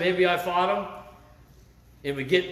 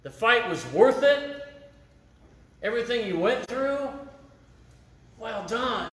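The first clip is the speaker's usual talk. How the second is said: higher, slow, proud well done; God's praise; 6 semitones higher, slower than usual. Retold or imitated. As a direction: give the blessing with joy. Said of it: "so God can tell you".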